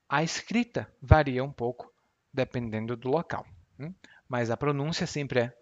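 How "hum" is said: ordinary delivery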